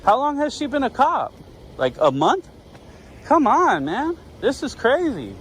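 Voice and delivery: high-pitched, exasperated protest; irritated. Real, not acted. Detained man complains about officer's competence, irritated. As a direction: push back with the pitch riding high. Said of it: "I'm getting irritated".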